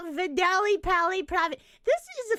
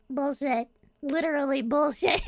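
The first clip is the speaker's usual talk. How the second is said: ordinary delivery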